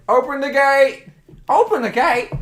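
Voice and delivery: high, loud comic command; Monty Python guard. Acted. Guard demanding the gate be opened, Monty Python shout. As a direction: holler it up high like a sketch-comedy sentry. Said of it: "I'm turning into the Monty Python".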